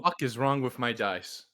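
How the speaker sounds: loud, emphatic swearing; exasperated outburst. Real, not acted. Player asking what is wrong with the dice, exasperated.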